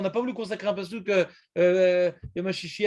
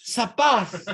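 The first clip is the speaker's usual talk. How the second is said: lilting Moroccan accent, higher; Moroccan singsong accent; 5 semitones higher, 5 dB louder than usual. Shown as a demonstration. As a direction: sing it with a Moroccan lilt. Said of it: "chantonnant marocaine".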